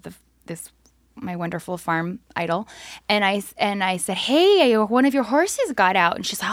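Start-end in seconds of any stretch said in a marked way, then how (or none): 4.2-6.2 eager call. Retold or imitated. Phoning the farm idol about her loose horse, eager. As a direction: announce it like a proud helper.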